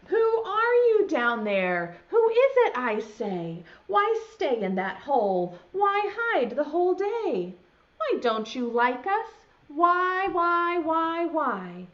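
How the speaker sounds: loud, high calling into a hole. Acted. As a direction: call down the hole, pleading to come out.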